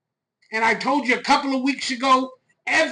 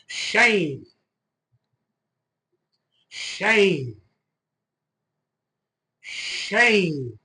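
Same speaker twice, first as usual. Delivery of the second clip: low, slow falling chant; solemn, drawn-out low reproach with long pauses; mock shaming; 5 semitones lower, much slower than usual. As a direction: toll it out like a public shaming. Mock public shaming chant after confession.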